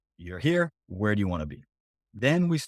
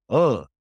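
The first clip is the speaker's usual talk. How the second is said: a short mock groan, a little louder; groan of dismay; 2 semitones higher, 7 dB louder than usual. Coach voicing a client caught without an answer, mock groan. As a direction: let out an imitated ugh of dismay.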